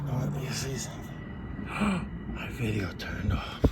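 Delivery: dismayed whisper. Real, not acted. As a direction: breathe it out in dismay. Notices camera stopped.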